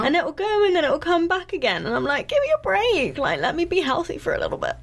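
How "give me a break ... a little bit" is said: high, fed-up plea with a laugh; exasperated. Retold or imitated. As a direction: throw up your hands, laughing. Frustrated quote about recurring cold.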